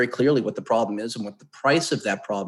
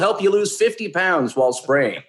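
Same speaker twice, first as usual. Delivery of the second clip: higher, hyped clickbait headline voice; clickbait video narrator; 9 semitones higher, 4 dB louder than usual. Pretend. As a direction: pitch it like a cheesy ad. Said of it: "some kind of clickbait".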